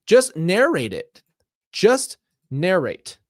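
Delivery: exasperated, slow, loud insistence; exasperated outburst. Real, not acted. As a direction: hammer the words out in frustration. Frustrated at the long instructions.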